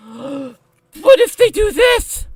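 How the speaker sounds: put-on high, slow whiny baby voice with a gasp; mock a scared little kid; mocking toddler. Mocking a panicky YouTube toddler, slow and whiny. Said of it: "some fucking toddler on YouTube goes".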